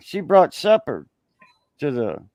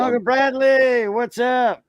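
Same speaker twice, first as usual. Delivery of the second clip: excited greeting; 8 semitones higher, slower than usual. Real, not acted. Greeting called out loudly.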